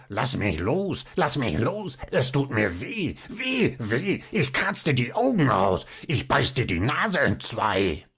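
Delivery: a thrashing brat screeching to be let go; Zinnober squawking. A narrator's reading. Zinnober struggling in the professor's arms, squawking. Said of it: "herum und quäkte".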